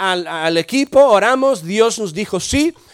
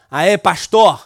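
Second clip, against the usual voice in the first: put-on a light Brazilian Portuguese accent on "Aê, pastor"; Brazilian regional accent; 3 semitones lower, 4 dB louder, slower than usual. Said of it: "en el acento de su región".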